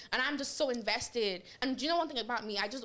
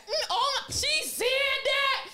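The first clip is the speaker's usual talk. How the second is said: high, slow, scandalised gossip with a laugh; gossiping housewife; 6 dB louder, slower than usual. Retold or imitated. To herself watching Housewives, high scandalised gossip. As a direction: gasp at the juicy drama like a reality star. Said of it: "that I could be like".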